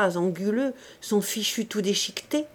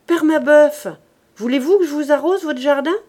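louder and higher, a brisk call from the hedge; girl calling to the old man over the garden, loud and quick; 6 semitones higher, 8 dB louder than usual. A narrator's reading.

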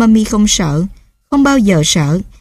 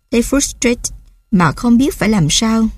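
usual speaking voice

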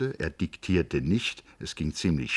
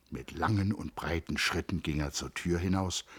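plain reading voice

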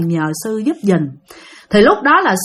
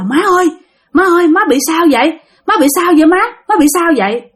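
gọi dồn dập, cao giọng và to hơn; hốt hoảng lay hỏi mẹ đang lạ thường; 7 semitones higher, 4 dB louder, faster than usual. Retold or imitated. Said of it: "giật mình hết hồn trơn". (con gái hoảng hốt hỏi mẹ, giọng cao và gấp)

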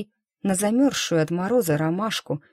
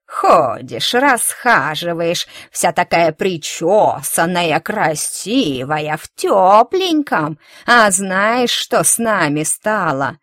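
slow, louder sneering crone; bitter old woman; 5 dB louder, slower than usual. Acted. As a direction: drip with contempt as a spiteful old hag. Old woman accuses the girl.

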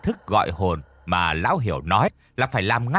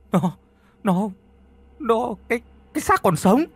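terrified stammer, much higher; 11 semitones higher than usual. A narrator's reading. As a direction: stutter in panic before blurting it out. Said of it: "Tâm thì lắp bắp". (lắp bắp hoảng sợ, giọng cao vút)

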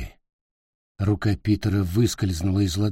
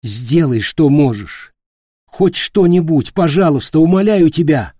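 higher and louder, rising to a plea; desperate begging; 7 semitones higher, 8 dB louder than usual. Acted. Peter desperately begging the queen to save Nick. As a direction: beg harder and harder. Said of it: "умоляюще заговорил он".

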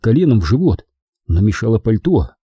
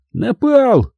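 much higher indignant repeat; indignant echo; 13 semitones higher, 3 dB louder than usual. Acted. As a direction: act offended to the core. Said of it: "возмущённо переспросил Петерсон".

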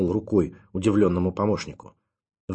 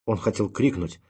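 ordinary delivery, nothing marked

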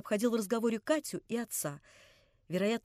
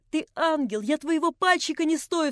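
higher and louder, gushing with rapture and remorse; rapturous remorseful cry; 6 semitones higher, 7 dB louder than usual. A narrator's reading. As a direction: burst out in delight and penitence. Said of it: "вскричал Алёша с восторгом и с раскаянием". (с восторгом и раскаянием, громко)

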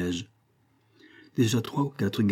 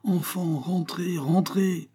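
crowd crying out; 8 semitones higher, slower than usual. A narrator's reading. The crowd urges the children inside, calling out high. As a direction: call out to children in a raised pitch. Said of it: "s'écrièrent de tous côtés des voix".

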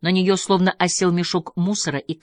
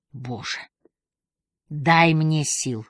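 exasperated sigh; much slower than usual. Acted. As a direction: sigh it out wearily. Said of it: "вздохнула она". (со вздохом, устало)

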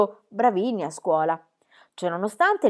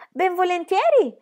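put-on cheeky girl voice; 11 semitones higher, 6 dB louder than usual. Pippi eagerly agrees.

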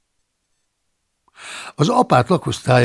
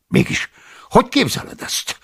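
sharp indignant high challenge; the abbot flares up at Paulus; angry outburst; 13 semitones higher, 4 dB louder than usual. Acted. Angry abbot confronts the intruding monk.

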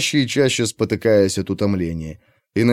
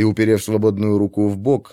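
usual speaking voice

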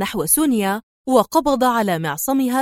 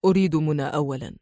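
low, quick, firm reply; deep voice; 7 semitones lower, 4 dB quieter, faster than usual. A narrator's reading. Adham demanding Mona first, in a deep voice. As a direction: drop deep and state it simply. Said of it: "ثم أجاب في صوت عميق".

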